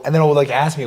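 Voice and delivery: ordinary delivery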